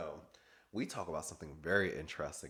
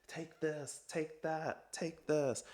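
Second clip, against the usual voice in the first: high and soft, imitating the girls handing things out; lightly voiced cheery giver; a hint of sing-song handing out presents; mock gift-giving voice; 8 semitones higher, 4 dB quieter, much slower than usual. Everybody handing out gifts.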